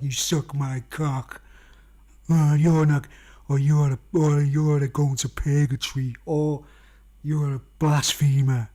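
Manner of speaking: slow, loud mock false Jesus voice; a leering fake Jesus at the door, drawn out.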